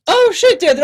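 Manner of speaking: high, loud startled shout; surprised exclamation. Acted. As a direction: jump in surprise. Character surprised to see him.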